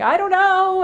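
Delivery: high, loud panicky squeal; freaked-out self. Retold or imitated. Wife quoting herself to husband, high loud panicked squeal. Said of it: "And I'm like".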